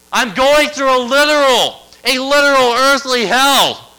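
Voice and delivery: shouting at full force, pitched very high; desperate cry to God. Acted. Jonah praying from inside the whale, desperate shouted cry. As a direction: cry out in anguish, drawing out the key words. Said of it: "I'm crying, I cried".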